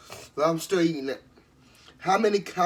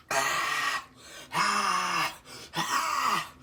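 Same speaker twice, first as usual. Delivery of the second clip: usual speaking voice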